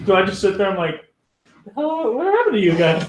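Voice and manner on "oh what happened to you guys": high-pitched, put-on bewilderment; hammed-up clueless concern; mock surprise. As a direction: act startled, pitch jumping way up. Acts out reaction to frozen companions.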